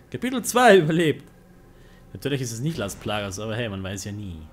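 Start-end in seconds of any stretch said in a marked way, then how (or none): 0.1-1.3 high, loud victory cheer; triumphant. Real, not acted. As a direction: cheer the survived chapter. Triumphant after chapter.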